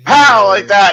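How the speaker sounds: high, loud shocked cry; stunned exclamation. Retold or imitated. Retelling getting whacked by a python, shocked and loud. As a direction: blurt it out in disbelief. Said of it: "And I just go".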